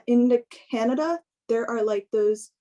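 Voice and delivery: ordinary talk, nothing marked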